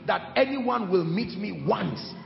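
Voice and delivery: loud, rising sermon fervour; fiery pulpit preaching; preaching at full force. Acted. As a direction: preach it hard to the crowd. Preaching to the congregation.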